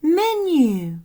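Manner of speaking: high, loud happy exclamation; thrilled little-girl cry; excited. Acted. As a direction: burst out with delight. Lissy names her crane.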